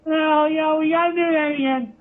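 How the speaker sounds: Paul insisting. Retold or imitated. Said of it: "Paul quoted again".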